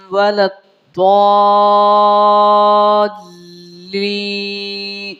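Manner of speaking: slow, chanted tajweed with long held vowels; Quranic recitation. Shown as a demonstration. Teacher recites for students, slow melodic Quranic chant. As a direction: intone each phrase, sustaining the endings.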